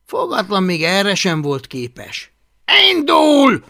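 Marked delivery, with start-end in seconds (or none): 2.9-3.6 high, very loud launch order; shouted command. A narrator's reading. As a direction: shout it to send a dragon flying. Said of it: "kiáltotta Hablaty".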